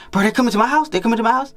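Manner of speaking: high, thrilled rising questions; can't believe they're coming over; excited friend. Retold or imitated. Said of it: "He's like".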